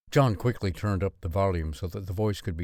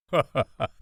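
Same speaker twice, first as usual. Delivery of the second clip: laughed; 5 semitones higher, 4 dB louder, much faster than usual. A narrator's reading. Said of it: "laughed Father".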